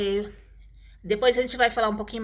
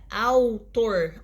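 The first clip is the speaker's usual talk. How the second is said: "autor" slowly parted into au and tor; syllable by syllable. Shown as a demonstration. Teacher showing a diphthong in one syllable, syllable by syllable. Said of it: "na mesma sílaba".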